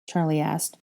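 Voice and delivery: ordinary delivery, nothing marked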